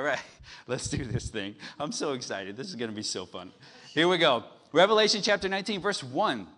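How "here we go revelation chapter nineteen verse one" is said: loud, fast, high call to read; rally the crowd into the reading; loud announcement. Real, not acted. Preacher to congregation, loud fast announcement.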